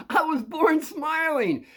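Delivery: put-on high, slow, crying-edged boyish voice; younger self. Answering JoJo as a boy, high and slow.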